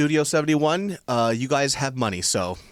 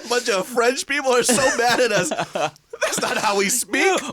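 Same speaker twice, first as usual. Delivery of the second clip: laughing; 10 semitones higher, slower than usual. Real, not acted. Laughing at own bit.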